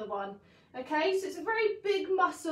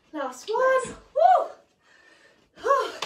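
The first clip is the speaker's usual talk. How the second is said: loud high whoop for the last rep; rally them for the finish; excited cheer; 7 semitones higher, 6 dB louder, much slower than usual. Real, not acted.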